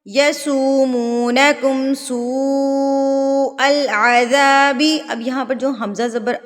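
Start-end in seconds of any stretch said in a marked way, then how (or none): 0.0-4.8 slow chanted tajweed recitation; Quran recitation. Acted. Teacher recites the verse in slow tajweed chant. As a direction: recite it slow and melodic, drawing out vowels.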